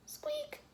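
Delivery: squeaky high-pitched squeak. A narrator's reading. Shelf squeaking as George leans on it. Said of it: "went the shelf again".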